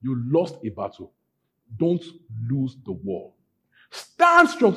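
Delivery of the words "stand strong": sudden high, loud rallying cry; rousing exhortation. Real, not acted. Preacher urging flock not to lose war, rousing shout. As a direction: call them to arms, pitch leaping up.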